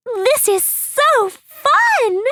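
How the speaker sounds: very high, slow giddy squeal; a whimsical girl squealing with glee; Maddie. Acted. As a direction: bounce with delight, stretched out. Maddie jumps excitedly.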